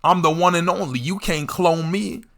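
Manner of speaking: lightly voiced old head boasting; a hint of a cocky uncle. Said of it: "They'll hit you with that".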